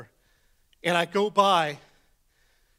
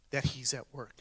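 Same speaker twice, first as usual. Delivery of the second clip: soft, unvoiced hush; whispered; 4 semitones lower, 8 dB quieter than usual. Real, not acted. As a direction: lean in and whisper the promise. Whispered sermon point.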